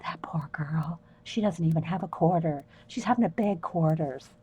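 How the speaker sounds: whispered bystander pity. Retold or imitated. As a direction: murmur it like onlookers pitying her. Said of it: "everybody's going".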